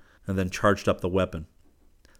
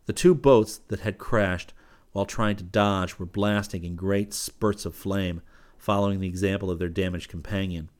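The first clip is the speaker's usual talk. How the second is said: ordinary delivery, nothing marked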